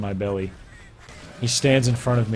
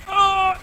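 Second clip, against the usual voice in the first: sudden shrill yelp, much higher; high squeal; 4 dB louder than usual. Real, not acted. Reader squealing at the steamy book. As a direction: let out a startled squeal. Squeal after reading sex scene.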